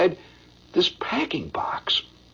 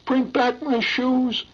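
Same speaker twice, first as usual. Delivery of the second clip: put-on higher, slow, begging child's voice; pleading little boy; 6 semitones higher, slower than usual. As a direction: beg like a lost little boy in the dark. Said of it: "this little kid said".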